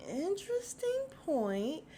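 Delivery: high, slow, put-on prim voice; drawn out, mock-thoughtful and high; mock Sutton. Imitating Sutton to her viewers in a prim, high voice. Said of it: "And Sutton's like".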